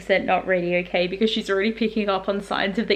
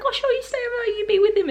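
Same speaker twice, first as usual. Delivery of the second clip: much higher, sugary mum impression; sweet mum voice; 12 semitones higher than usual. Vlogger voicing the kind mum, much higher. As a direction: play a doting mum. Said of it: "she's like".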